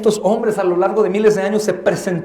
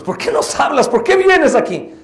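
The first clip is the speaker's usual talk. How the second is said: high, rising protest; acted indignant challenge; 8 semitones higher, 3 dB louder than usual. Possessed man challenging Jesus for coming, indignant. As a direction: challenge him, pitched well up.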